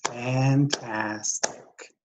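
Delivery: "Fantastic" slowly, in three distinct beats; clapped-out syllables. Shown as a demonstration. Teacher clapping for students, "Fantastic" slowly split in three. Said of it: "clapped three times".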